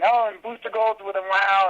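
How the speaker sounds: mock breathless fan chatter; gushing fans. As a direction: gush like a thrilled fan online. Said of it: "they're all talking about".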